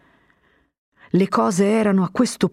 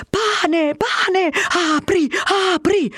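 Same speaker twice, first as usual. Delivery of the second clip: crowd clamouring; 13 semitones higher, much slower than usual. A narrator's reading. Hungry crowd drowning out the captain, clamouring. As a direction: chant it like a mob.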